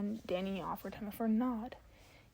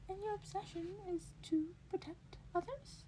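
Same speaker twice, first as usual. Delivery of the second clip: lightly raised, whispered curious principal; Nezu's voice; 10 semitones higher, 7 dB quieter than usual. Acted. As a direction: ask it softly, a little higher, like a clever small mentor. Nezu voiced by reader.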